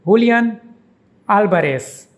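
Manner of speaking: Julian as hoo-lee-AHN, Alvarez as AL-ba-res; Spanish pronunciation. Shown as a demonstration. Teacher demonstrates the footballer's name, saying it the Spanish way. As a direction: Julian with an h sound and stress on the last syllable, Alvarez with a b for v and an s ending. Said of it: "is pronounced".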